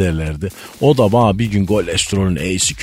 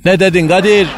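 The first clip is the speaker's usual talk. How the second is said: mock shout; 11 semitones higher, 5 dB louder than usual. Eşber hoca sketch, spoofed outburst.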